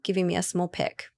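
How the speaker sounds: usual speaking voice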